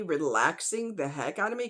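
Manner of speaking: usual speaking voice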